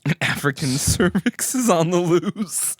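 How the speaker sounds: laughing news read. Pretend. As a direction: read it like breaking news, giggling. Mock news reading.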